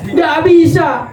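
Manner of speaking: high, emphatic cry of amazement; exclaiming in disbelief. Acted. Badui telling Ali the companions only wept, astonished outcry. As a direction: call it out as if astonished.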